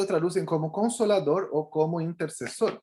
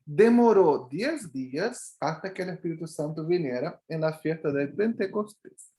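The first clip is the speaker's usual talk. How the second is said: ordinary talk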